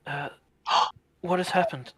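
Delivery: gasp, then a confused question; startled gasp. Acted. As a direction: wake with a jolt and ask. Akari waking, confused.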